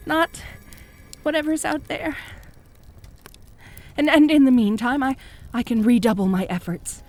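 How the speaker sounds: ordinary delivery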